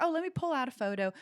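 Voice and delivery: lightly put-on Southern accent. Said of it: "she had a Southern accent too".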